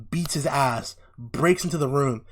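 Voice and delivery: ordinary talk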